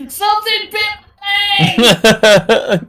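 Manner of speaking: shouting. Acted. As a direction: yell it across the water. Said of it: "Zatara as she shouts".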